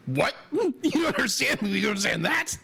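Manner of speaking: high, laughing mock disbelief; imitated astonishment. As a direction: act out being caught off guard, pitch jumping up. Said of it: "He's like".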